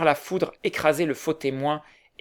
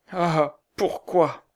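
dazed repetition; 3 semitones higher, much slower than usual. A narrator's reading. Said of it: "répéta le malheureux".

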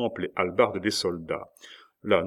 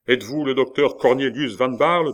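loud, raised official questioning; grave interrogation; 5 semitones higher, 6 dB louder than usual. A narrator's reading. Magistrate identifying the suspect, grave interrogation. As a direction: question him formally, with authority. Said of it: "une grande gravité à l'interrogation".

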